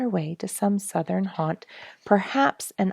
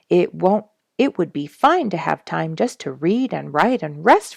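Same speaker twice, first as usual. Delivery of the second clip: firm, louder, every point pressed; with emphasis; 5 dB louder than usual. A narrator's reading. Narrator voicing himself, firm and louder. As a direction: lay down the resolve firmly. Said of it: "I said with emphasis".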